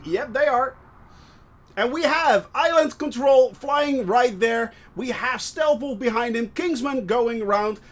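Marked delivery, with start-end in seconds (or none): none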